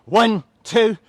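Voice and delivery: loud, high count-off over the music; energetic coach calling reps; shouted counts. Real, not acted. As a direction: bark the reps out to the class.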